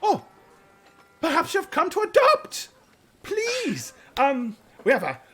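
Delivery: high, flustered, apologetic voice; a harried woman gushing apologies; high, hurried, fluttery matron voice; frazzled headmistress. Acted. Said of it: "She looks at you and she says".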